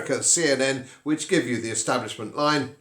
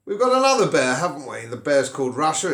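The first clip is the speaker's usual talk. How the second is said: ordinary talk, nothing marked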